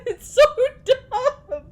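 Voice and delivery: loud high tearful wail; real crying. Real, not acted. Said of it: "I'm crying over pixel".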